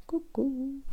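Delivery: soft unvoiced whisper; tiny whispered coucou; whispered hello. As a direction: breathe the greeting as a secret. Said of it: "Si je vous fais la main gnome".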